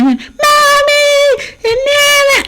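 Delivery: very high-pitched mock kid scream; imitated child screaming. Child screaming for mommy from another room, mock high screech. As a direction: screech like a kid wailing for a parent. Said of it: "then they start screaming".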